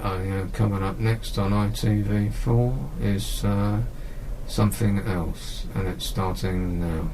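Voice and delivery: low, slow, mumbled announcer with the voice dropping away; lazy announcer impression. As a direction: do the ITV4 announcer badly, droning and fading. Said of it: "he talks like this".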